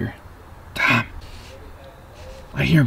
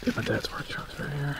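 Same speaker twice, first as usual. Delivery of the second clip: whispering; 9 dB quieter, much slower than usual. Real, not acted. Sneaking past dad's truck.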